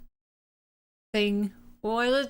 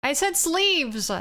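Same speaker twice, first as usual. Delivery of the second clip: exasperated shout; 8 semitones higher, 3 dB louder, slower than usual. Real, not acted. Correcting the drawing, insisting.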